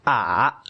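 "ah" dipping down then back up; third tone. Shown as a demonstration. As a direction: let "ah" fall and climb again evenly. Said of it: "goes down and back up again".

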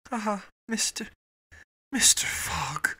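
breathy, whispered exclamation; emotional cry. A narrator's reading. Auda to Fogg as he vows rescue, hushed emotional cry. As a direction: cry it out under the breath, slowing on the name. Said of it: "cried she".

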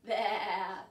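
put-on playful sheep baa for kids; sheep bleat. Teacher answering what a sheep says with a bleat. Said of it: "what's a sheep say?".

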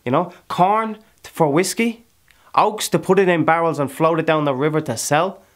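loud, high, impassioned boast; fierce boasting. Acted. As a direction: proclaim your hard-won riches at full voice. Character boasts heatedly of his land.

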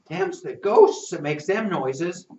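ordinary delivery, nothing marked

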